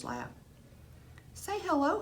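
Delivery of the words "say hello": gentle instruction. Acted. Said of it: "Saeed gently instructed".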